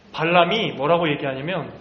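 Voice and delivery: normal talking voice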